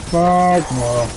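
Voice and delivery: ordinary talk, nothing marked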